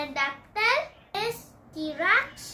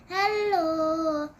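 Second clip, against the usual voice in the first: ordinary talk, nothing marked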